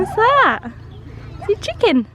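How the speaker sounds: very high singsong prompting. Real, not acted. Mum naming the chicken for child, high singsong.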